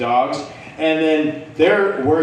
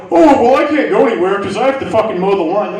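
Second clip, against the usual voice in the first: whiny mock excuse, higher and louder; mocking imitation; 5 semitones higher, 5 dB louder, faster than usual. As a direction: mimic his excuses in a rushed, high voice. Mimicking the man's excuses.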